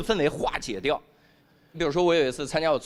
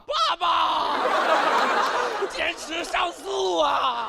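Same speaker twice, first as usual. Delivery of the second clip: high, drawn-out mock wail; prison-visit cry; 12 semitones higher, much slower than usual. Baby as prisoner phoning dad through glass, high wail. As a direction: cry out high and slow, hammed up. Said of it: "我儿子在里面一手拍着玻璃".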